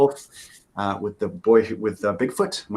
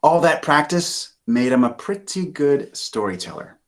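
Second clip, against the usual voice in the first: ordinary delivery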